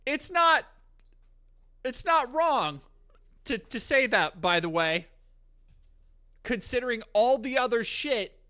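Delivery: loud and high, fired up; heated rant. Real, not acted. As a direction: push it out hard, indignant. Heated rant against streamer.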